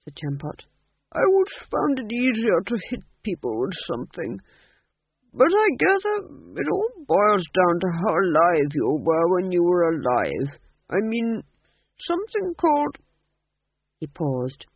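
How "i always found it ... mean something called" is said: put-on high, swooping old man's voice; genial, rambling old ghost; musing old ghost king. A narrator's reading. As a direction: grope for the words as an old king.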